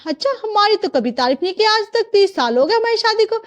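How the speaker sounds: high-pitched playful complaint; mock-aggrieved wife. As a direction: tease with wounded indignation. Wife jealous of praise for bride.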